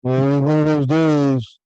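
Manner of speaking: very slow, dragging misery voice; mopey gloomy reply. Shown as a demonstration. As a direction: sound like the day has beaten you down. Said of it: "leaning down like this".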